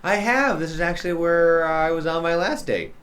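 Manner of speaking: high-pitched breezy confession; teasing date. Acted. Game master voices the date.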